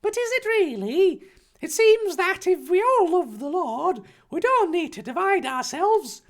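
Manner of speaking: very high put-on character voice; questioning Harry. Harry questioning Dumbledore about hat divisions, earnestly.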